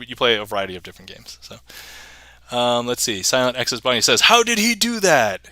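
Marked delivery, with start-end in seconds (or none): 4.2-5.5 high slow amazed question. Retold or imitated. Reading a viewer's amazed question, high and slow. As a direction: marvel at it.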